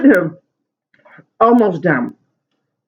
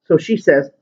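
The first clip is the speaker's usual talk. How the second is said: usual speaking voice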